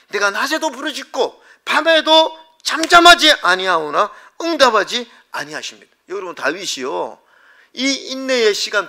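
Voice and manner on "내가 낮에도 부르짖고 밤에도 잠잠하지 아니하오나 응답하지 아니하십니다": high, loud psalmist's cry; acted anguished appeal at raised pitch; impassioned lament. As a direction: cry it out to an unanswering God. Acting David crying out unanswered.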